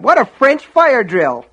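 high, loud, slow disgusted cry; exasperated shout. Acted. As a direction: bellow at the mess. Norris shouting in chaos.

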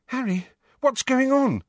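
put-on high, anxious young girl; frightened girl. A narrator's reading. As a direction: ask in alarm. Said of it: "who looked frightened".

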